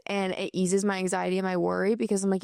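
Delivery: normal talking voice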